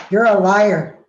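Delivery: acted-out angry shout; shouted accusation. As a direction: hurl it out as an accusation. Student's loud accusation, acted out.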